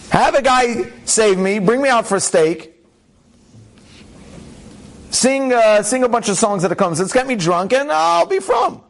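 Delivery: imagining the rabbi asking God for rescue, mock pleading; mock plea to God. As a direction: beg heaven half-jokingly to be rescued.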